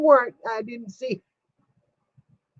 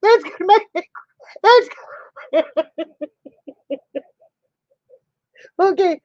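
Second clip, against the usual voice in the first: high-pitched, breaking up laughing; helpless laughter; 6 semitones higher, faster than usual. Real, not acted. Friend cracking up at her own joke, helpless laughter. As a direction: lose it completely mid-sentence. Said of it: "okay, I've lost it".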